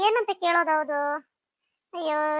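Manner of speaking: ordinary talk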